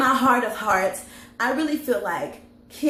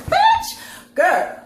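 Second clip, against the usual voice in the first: high, loud shriek of "Bitch"; shrieked quote; 6 dB louder than usual. Retold or imitated. Phaedra snapping at Kenya with a high, loud shriek. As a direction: squeal it out as she snaps. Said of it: "and she was just like".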